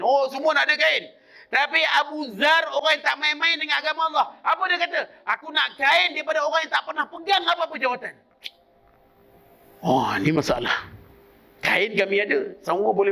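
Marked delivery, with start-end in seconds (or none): 0.0-8.1 high-pitched, driving sermon delivery; fervent preacher pressing the point home; impassioned preaching. Acted. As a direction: preach it with raised, insistent pitch.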